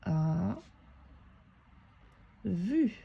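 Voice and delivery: words separated. Shown as a demonstration. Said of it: "on sépare les mots".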